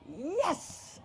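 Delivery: put-on high, triumphant kid's yes; delighted cheer. Boy thrilled that dinosaurs come with everything, delighted. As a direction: pop it up high in glee.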